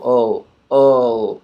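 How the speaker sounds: ul said as a quick schwa then L; ul sound. Shown as a demonstration. Teacher showing the hidden vowel in L, quick. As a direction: clip ul short, quick uh into L. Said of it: "quick vowel".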